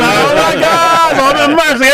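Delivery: high, drawn-out appalled exclamation; hammed-up cry for mercy; mock horror. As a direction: draw it out slowly, scandalized. Reacting to friend's embarrassing remark.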